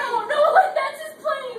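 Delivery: loud crying protest; sobbing insistence. Acted. Wife insisting to her friend it's his plane, crying loudly. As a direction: cry it out in despair.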